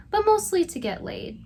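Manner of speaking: mock him with a sharp high-pitched mostly; mocking emphasis. Commentator mocks the nephew's real motive, sneering high emphasis.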